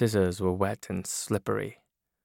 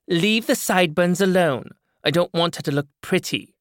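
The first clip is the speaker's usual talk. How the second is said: higher, louder brassy woman's voice; loud, higher voice of a tough older woman; brash woman character; 9 semitones higher, 5 dB louder than usual. Acted. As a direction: play the hard-bitten woman with the scissors.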